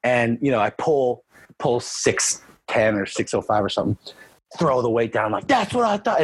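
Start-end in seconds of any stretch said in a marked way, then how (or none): 5.5-6.2 high, smug gloat after the lift; cocky taunt. Retold or imitated. Lifter gloating at his coach after a big pull, high and cocky. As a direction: rub it in with a high cocky jab.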